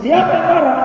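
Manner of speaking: sangat tinggi dan keras, menyangkal; bantahan marah. Retold or imitated. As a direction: teriak tersinggung menyangkal marah. Istri membantah. (istri menyangkal kepada suami, melengking marah)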